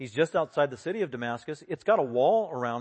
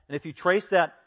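ordinary delivery

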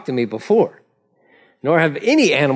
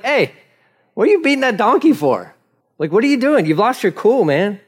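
high-pitched, casual telling-off; scolding; 6 semitones higher than usual. Acted. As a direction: take him to task for losing his cool. The Lord scolds Balaam in retelling.

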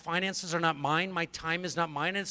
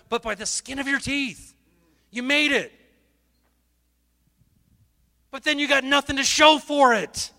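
loud, fervent preaching to the crowd; full-force preaching; 7 semitones higher, 10 dB louder than usual. Acted. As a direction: preach it hard, louder and higher.

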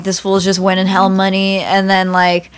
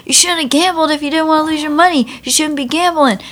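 higher, fast preachy scold; scammer scolding; 7 semitones higher than usual. Retold or imitated. Scammer telling homeless men off, smug high fast scold. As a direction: lecture them like a smug hustler.

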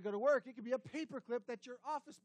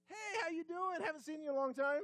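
friendly judge; 6 semitones higher, 3 dB louder than usual. Retold or imitated. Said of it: "the judge is like".

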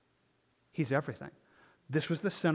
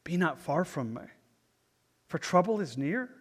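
slow, solemn psalm reading with long pauses; slow scripture reading; slower than usual. Real, not acted. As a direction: read slowly, leaving space to think it through. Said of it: "Try to read it slowly".

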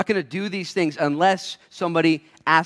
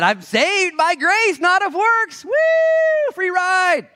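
much higher, louder, slow gloating cry; mocking carefree believer; 7 dB louder, much slower than usual. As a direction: ham up a careless believer cheering his free ride. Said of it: "And this person said".

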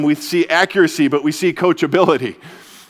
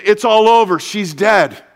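louder, higher alarmed cry of the crowd; panicked crowd; 5 semitones higher, 5 dB louder than usual. Retold or imitated. The crowd panicking at Jairus, with a raised alarmed cry. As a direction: announce the death in a panic. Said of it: "the world is going crazy".

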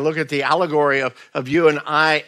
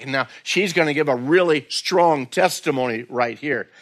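usual speaking voice

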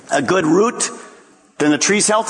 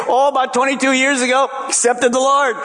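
complacent believer; 8 semitones higher than usual. Retold or imitated.

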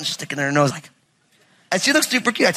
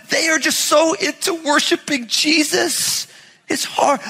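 higher, slow, mock-sorrowful complaint; mock lamenting parent; 9 semitones higher than usual. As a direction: whine about kids loving Jesus too much. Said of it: "I've never once said".

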